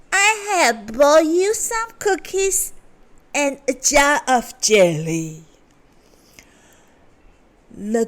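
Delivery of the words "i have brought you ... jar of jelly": high, louder put-on girl voice; wolf faking a girl. A narrator's reading. Wolf at the door posing as granddaughter, high and loud. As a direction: sell the disguise, pitched up and loud. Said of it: "said the wolf".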